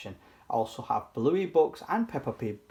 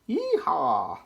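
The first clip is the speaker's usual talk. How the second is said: cowboy cheer. Acted. Bees cheer.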